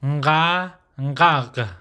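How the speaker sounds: "nga" held open, "ngag" closed at the throat; nasal onset on "nga" and "ngag"; guttural nasal demonstration. Shown as a demonstration. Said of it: "is pronounced".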